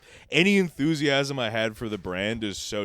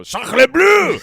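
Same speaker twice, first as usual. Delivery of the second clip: shrieked high, loud French cry; hammed-up French chef yelp; French chef shrieking at rats in the kitchen; French chef exclamation; 14 semitones higher, 15 dB louder than usual. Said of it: "you hear a French chef go".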